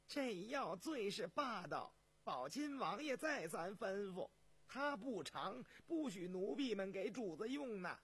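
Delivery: high, drawn-out servant voice; high-pitched deferential caution; eunuch cautioning his master. Acted. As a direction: warn the emperor humbly. Eunuch warns the emperor.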